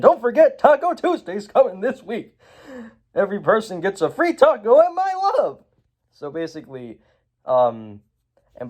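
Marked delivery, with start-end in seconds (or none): 0.0-5.6 high, hammed-up announcer voice; overly sweet high broadcast voice; TV villain. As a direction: pitch it up like a cheesy TV boss. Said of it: "Lord Business comes on the T V and is like".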